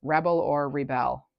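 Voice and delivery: "Rebel" as REB-el, then "rebel" as re-BEL; two readings of REBEL. Shown as a demonstration. Tarot reader repeating the word both ways, REBEL stressed two ways. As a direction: stress the first syllable of "Rebel", then the second of "rebel". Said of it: "however you want to read the word".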